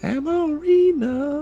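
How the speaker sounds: high, stretched-out playful name; drawn-out intoning. Pretend. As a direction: draw the name out slowly, up high.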